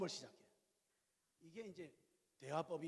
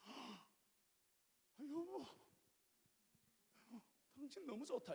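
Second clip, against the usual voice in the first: higher, soft and pleased wife's reply; delighted wife; 7 semitones higher, 9 dB quieter, slower than usual. Retold or imitated. Wife thrilled her husband understood, soft and high. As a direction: coo back happily as the pleased wife. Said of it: "저희 집사람 뭐라 할게요?".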